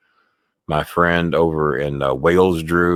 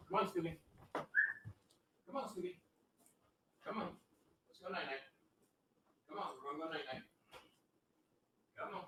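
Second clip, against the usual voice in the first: higher, softer coaxing dog voice; soft pet talk; 9 semitones higher, 24 dB quieter than usual. Real, not acted. Owner coaxing Scooby into his cage for night-night, soft pet talk. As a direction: lull the dog toward bed.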